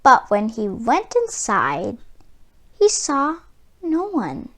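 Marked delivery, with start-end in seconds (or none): none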